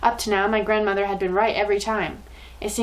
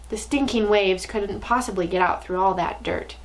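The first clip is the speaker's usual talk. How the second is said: usual speaking voice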